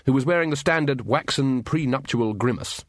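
ordinary delivery, nothing marked

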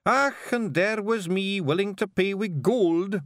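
high, slow creature voice; strange creature. A narrator's reading. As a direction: bargain slowly in a high, odd voice. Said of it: "said the creature".

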